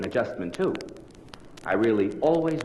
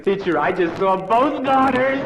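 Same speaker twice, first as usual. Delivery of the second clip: put-on higher, slow, wide-eyed boy; cheeky schoolboy; 9 semitones higher, 4 dB louder, slower than usual. Schoolboy innocently tells the teacher what he saw.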